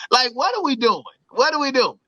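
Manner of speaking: ordinary talk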